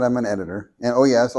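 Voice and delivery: ordinary talk, nothing marked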